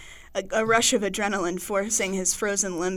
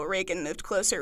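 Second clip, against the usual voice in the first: normal talking voice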